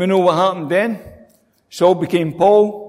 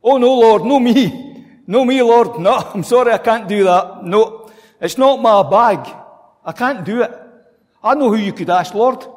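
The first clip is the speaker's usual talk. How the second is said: mock pleading, higher and louder; reluctant believer; 4 semitones higher, 4 dB louder than usual. Believer dodging God's call, mock pleading. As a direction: ham up a churchgoer wriggling out. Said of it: "how do we respond?".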